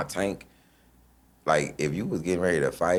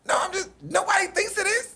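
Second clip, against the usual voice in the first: very high, fast, innocent squeak; mock innocent; faster than usual. Joker denying to the laughing tank, squeaky and fast. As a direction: squeak it out like you did nothing.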